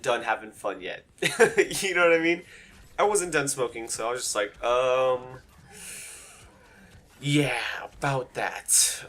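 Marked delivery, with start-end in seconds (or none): 4.6-9.0 reluctant stalling. Retold or imitated. Reluctantly answering friend's plea to leave.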